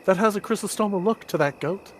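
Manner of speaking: lightly voiced excited girl; Sophia voice. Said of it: "Sophia turns around and goes".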